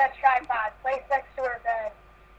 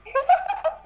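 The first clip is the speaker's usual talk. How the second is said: high, squealing laugh; burst of laughter. Real, not acted. Reader breaks down laughing at the text. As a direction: shriek with laughter.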